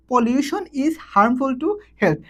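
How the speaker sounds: very slow, each English word spaced out; slow dictation. Shown as a demonstration. Teacher dictating an example sentence to students, very slow. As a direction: read it out word by word for the class. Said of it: "teacher reads example sentence".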